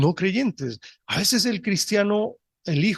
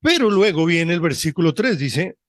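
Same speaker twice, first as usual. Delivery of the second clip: ordinary delivery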